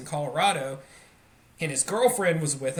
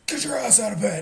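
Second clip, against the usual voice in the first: dad's wake-up bark; 6 semitones higher than usual. Retold or imitated. Dad voiced yelling wake-up.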